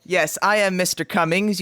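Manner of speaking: ordinary talk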